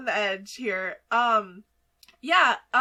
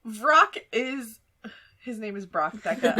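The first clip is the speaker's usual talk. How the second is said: normal talking voice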